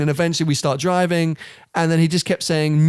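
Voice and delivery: usual speaking voice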